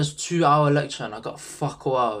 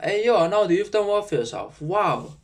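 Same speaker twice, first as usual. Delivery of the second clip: higher-pitched imagined shocked greeting; 7 semitones higher, 4 dB louder than usual. Acted. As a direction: act out a mildly amazed past self. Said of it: "I think he'd be shocked".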